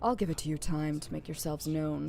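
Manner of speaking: low, controlled voice of authority; stern commanding leader. Acted. Plays a commanding game character.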